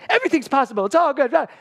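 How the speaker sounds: loud, high-pitched, hammed-up enthusiasm; over-excited optimism. Newcomer bubbling at depressed older actors, wildly over-excited. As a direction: parody your relentlessly upbeat younger self. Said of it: "so excited about everything".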